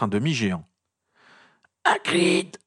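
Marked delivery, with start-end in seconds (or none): 1.8-2.6 high, squeezed cry; breathless croak. A narrator's reading. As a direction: croak it out as if crushed. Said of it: "coassa-t-il, tentant de reprendre son souffle". (coassement aigu, le souffle coupé)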